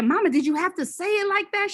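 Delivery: hurt protest. Retold or imitated. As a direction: plead against the harsh words. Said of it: "And I said".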